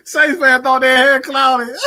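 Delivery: high, gleeful jeer between laughs; mocking through laughter. Real, not acted. Teasing Saints fans about Clowney, laughing mockery. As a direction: crow it while cracking up.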